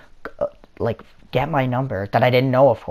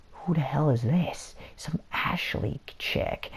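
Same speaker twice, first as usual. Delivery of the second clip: hushed, higher-pitched whispering mom; mock mom's hushed aside; imitated mom; 5 semitones higher, 7 dB quieter, slower than usual. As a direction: whisper it like a suspicious mother. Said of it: "My mom goes like this".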